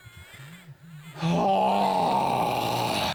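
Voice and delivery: long, drawn-out voiced "oh" sigh. Mother settling in to watch TV, long sigh. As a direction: heave a big, drawn-out sigh.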